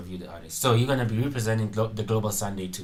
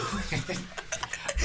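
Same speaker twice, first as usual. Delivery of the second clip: airy giggle, pitched up; breathy high laugh; 9 semitones higher, 9 dB quieter than usual. Real, not acted. Laughter at friends.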